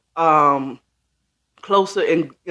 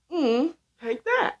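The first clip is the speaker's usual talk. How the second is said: sassy taunt; 9 semitones higher, 4 dB quieter, slower than usual. Real, not acted. Teasing DC pride boast.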